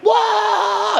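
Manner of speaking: high shouted whoa; startled whoa. Retold or imitated. Meeting the breathwork teacher, a high startled shout. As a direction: yelp in surprise. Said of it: "And I'm like".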